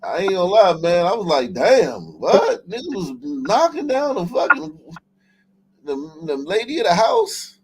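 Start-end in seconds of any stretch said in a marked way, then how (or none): none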